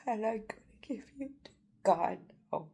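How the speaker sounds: fast tearful whisper; emotional. Real, not acted. Handing her blamers to God, tearful whisper. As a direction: whisper it, overcome. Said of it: "I don't mean to get emotional".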